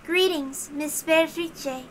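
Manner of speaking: a composed, courteous officer of heaven; formal knight Dalnor. Acted. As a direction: speak each word with stately slowness. Dalnor voiced in read story.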